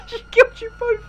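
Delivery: high squeal through laughter; laughing. Real, not acted. Laughing at two friends who got killed, high giggly squeal. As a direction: squeal it out while cracking up.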